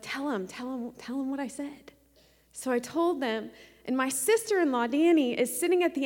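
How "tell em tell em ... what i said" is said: much softer inner nudge; a quiet prompting voice inside her; hushed urging. Retold or imitated. The Lord prompting her at Bible study, hushed.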